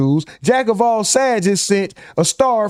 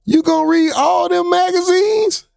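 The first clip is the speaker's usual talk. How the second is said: Martin teasing; 11 semitones higher than usual. Retold or imitated. Martin teasing Angry Man about the magazines, high mocking voice. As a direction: squeal it out like Martin clowning. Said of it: "Remember when Martin asked Angry Man".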